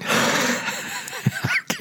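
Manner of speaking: breathy, unvoiced chuckle; soft amused giggle; airy laugh. Real, not acted. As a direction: laugh at the odd video. Amused at two guys cuddling.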